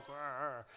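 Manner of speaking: low, quiet mock growl; a playful little growl. On the escalator beside Melania, a quiet mock growl. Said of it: "and I'm going".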